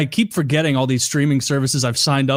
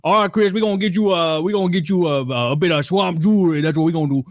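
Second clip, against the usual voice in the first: higher-pitched mock Cajun guy; hammed-up Cajun accent, pitched up; goofy Cajun accent; 4 semitones higher than usual. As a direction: imitate a Cajun swamp guide, goofily. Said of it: "like a goofy ass accent".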